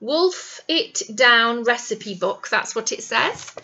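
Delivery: usual speaking voice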